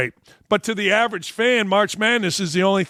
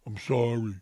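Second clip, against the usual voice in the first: mock meek apology; 7 semitones lower, 8 dB quieter than usual. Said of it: "crawl up like a little baby".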